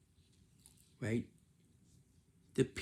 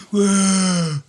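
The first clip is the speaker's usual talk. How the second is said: high, loud mock whoop; teacher showing students a rude whoop, loud and high; 10 semitones higher, 17 dB louder than usual. Shown as a demonstration. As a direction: let out a rowdy whoop to show the bad behaviour. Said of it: "and do this".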